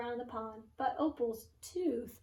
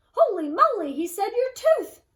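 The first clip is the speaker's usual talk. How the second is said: loud, high astonished cry; surprised; 8 semitones higher, 13 dB louder than usual. Acted. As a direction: blurt out the discovery. Sudden discovery of lost tooth.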